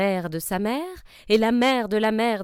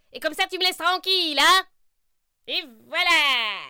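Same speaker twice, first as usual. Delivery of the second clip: sorcière impatiente; 8 semitones higher, 4 dB louder than usual. Acted. Sorcière pressée d'en finir.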